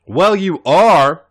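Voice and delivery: insist, stretching it out; loud insistence. A narrator's reading. A J insisting to Raven, loud and slow. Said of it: "he insisted".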